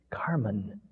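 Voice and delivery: soft unvoiced whisper. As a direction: breathe the name without voice. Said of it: "Neither could I speak with full voice".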